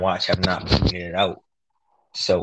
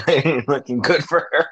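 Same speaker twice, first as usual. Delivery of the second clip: higher voice breaking into laughter; cracking up while talking; laughing; 6 semitones higher, 4 dB louder than usual. Real, not acted. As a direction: say it through a real laugh. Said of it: "I don't know why I'm laughing".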